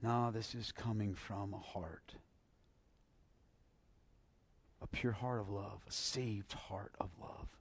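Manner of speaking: soft, slowing, near whisper on saved heart; hushed tender. Real, not acted. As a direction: let it fall to a whisper. Preacher's hushed tender climax.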